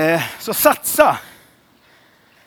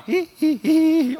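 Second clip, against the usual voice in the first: a hint of a high, tentative dancer; mock timid dancer; 9 semitones higher, much slower than usual. Pastor mimicking a dancer's first step, lightly high mock voice. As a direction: squeak it out like a shy beginner.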